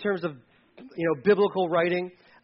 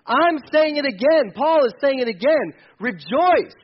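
louder and higher, slow and insistent; emphatic shout; 7 semitones higher, 7 dB louder, slower than usual. Real, not acted. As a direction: drive it home to the congregation. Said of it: "repeating the call to rejoice".

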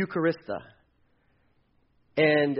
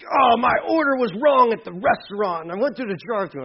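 higher, louder, half-crying whine; mock wailing complaint; 7 semitones higher, 5 dB louder than usual. Pastor mocking a drive-thru complaint, hammed-up wailing. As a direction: wail over the wrong order. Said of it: "first world problems".

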